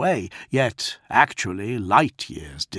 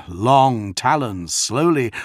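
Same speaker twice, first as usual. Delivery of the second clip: ordinary delivery, nothing marked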